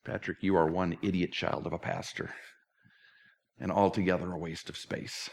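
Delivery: tired, self-accusing voice at bedtime; bleak self-talk. Retold or imitated. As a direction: talk yourself down, alone at night. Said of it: "I'm gonna be sitting in the dark saying".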